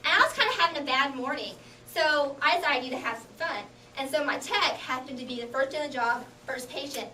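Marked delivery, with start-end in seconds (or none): none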